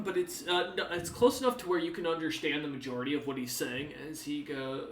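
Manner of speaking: ordinary talk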